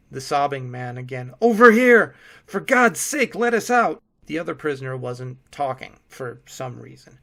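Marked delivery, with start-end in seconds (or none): 1.4-4.0 very loud high desperate plea. A narrator's reading. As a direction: beg to be let out. Sobbing man begs release.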